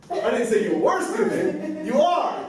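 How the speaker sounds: "were" punched high, then "are" to match; stress on WERE. Shown as a demonstration. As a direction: hit "were", implying it is still true. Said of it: "emphasize that word so we can change".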